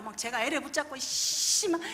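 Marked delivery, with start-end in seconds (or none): none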